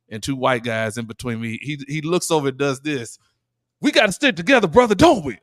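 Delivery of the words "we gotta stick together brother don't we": high, loud acted-out plea to a brother; chummy mock appeal. Big passenger appealing to a stranger across the row, chummy mock appeal. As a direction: put on an exaggerated pitch for solidarity.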